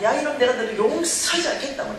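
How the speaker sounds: retold raging. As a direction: re-enact an angry outburst, slightly toned down. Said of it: "이제 난리가 났죠".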